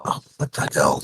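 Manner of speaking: delayed echo of own voice. Real, not acted. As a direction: repeat yourself as an echo. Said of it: "I don't know why I'm echoing".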